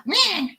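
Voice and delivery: short, high bleating squawk; unconvincing high meh; attempted animal noise. Shown as a demonstration. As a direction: try a high animal cry, unsure. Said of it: "that doesn't sound right".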